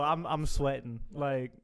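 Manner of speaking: ordinary talk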